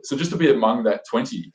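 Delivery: ordinary talk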